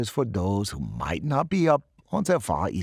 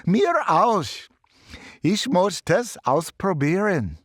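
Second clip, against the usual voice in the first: high, loud eager cry; eager shout; 8 semitones higher, 4 dB louder than usual. Acted. Student calls out to the professor, eager and loud. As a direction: call out, desperate for a turn. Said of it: "More voices jumped in".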